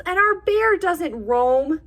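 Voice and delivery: high squeaky mouse voice; squealing mouse. Acted. Worried Mouse telling friends it is late, high squeak. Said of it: "Mouse squeals".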